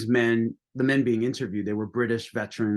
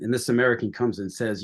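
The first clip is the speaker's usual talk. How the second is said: ordinary delivery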